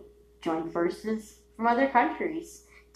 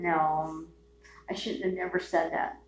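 ordinary talk

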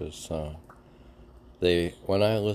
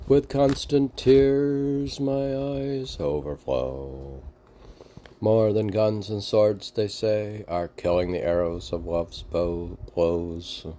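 slow, higher, mournful poem reading; dramatic lyric recitation; 3 semitones higher, 3 dB louder than usual. Acted. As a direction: declaim it like a tragic ballad. Recites lyrics amid chat.

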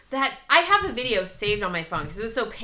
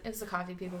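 hushed; 3 semitones lower, 12 dB quieter, faster than usual. Retold or imitated. Whispering again to Zach.

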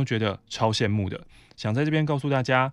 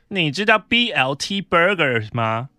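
mock snobby coworker; 4 semitones higher, 4 dB louder, slower than usual. Mocking coworker's snobby English.

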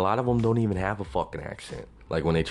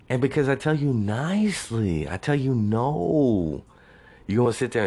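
exasperated; 6 semitones higher than usual. Acted. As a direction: stretch the key words, pitched up in protest. Indignant address to absent suitor.

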